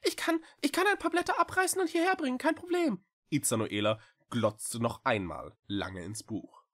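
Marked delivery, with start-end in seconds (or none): 0.0-3.0 high, fast, tumbling cat voice; eager little cat. A narrator's reading. Echo offering the witch the leaves, fast and eager.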